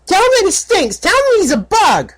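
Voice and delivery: high, loud bratty taunt; little filly voice. Acted. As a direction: egg him on like a cheeky little girl. Filly egging on.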